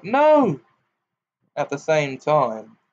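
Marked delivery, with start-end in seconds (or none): 0.0-0.6 high, loud yell of alarm. A narrator's reading. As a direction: shout to stop him. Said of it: "Both Harry and Ogden yelled".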